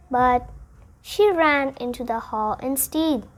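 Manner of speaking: usual speaking voice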